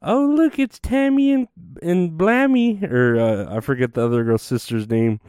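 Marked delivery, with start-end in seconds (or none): 0.0-2.8 high, sing-out imitation of a reality show promo; mock TV promo voice. Mocking how TLC markets the sisters, in a high cutesy promo voice. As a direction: put on a much higher, cutesy promo voice. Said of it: "how they market that, where they're like".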